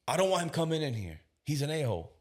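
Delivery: high, emphatic protest; mock objection. Father objecting to the backup officer, mock objection.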